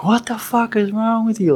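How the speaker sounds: high-pitched imitation of a furious captain; retold angry outburst. Captain confronts the Humvee driver, imitated mad yelling. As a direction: act out going off on someone, pitch way up. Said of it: "He was mad as hell".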